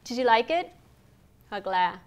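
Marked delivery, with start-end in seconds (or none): 0.0-0.7 "Did you" reduced to "didju"; swallowed letters. Shown as a demonstration. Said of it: "tôi nuốt chữ cái trong câu sau đây".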